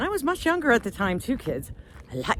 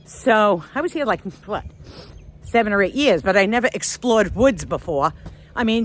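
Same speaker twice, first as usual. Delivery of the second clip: normal talking voice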